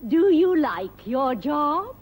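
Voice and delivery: ordinary talk, nothing marked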